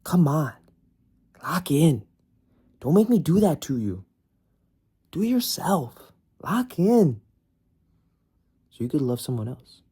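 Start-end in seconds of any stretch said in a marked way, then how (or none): 0.0-7.2 higher, louder hype-man push; mock coach, getting higher; pep talk. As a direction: fire up the viewer like a coach. Hyped coaching the viewer.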